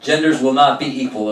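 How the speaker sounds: ordinary delivery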